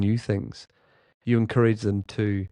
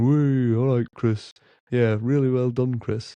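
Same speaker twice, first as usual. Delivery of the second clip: teasing cheer; 2 semitones higher, slower than usual. Retold or imitated. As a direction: rib him with a cheeky woo. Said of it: "he was only having a laugh".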